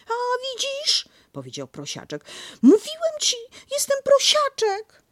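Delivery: vindicated little pig; Piglet triumphant. Acted.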